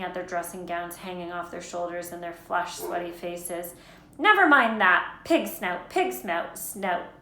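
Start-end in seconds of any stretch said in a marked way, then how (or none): 4.2-7.2 loud start, then short puffs; a touch of breathless urgency; panting. A narrator's reading. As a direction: blurt the password as if winded. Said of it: "panted Harry".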